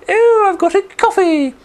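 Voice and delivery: very high, loud squeak; squeaky little creature. Acted. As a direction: squeal it like a tiny woodland creature. Tiny creature crying out.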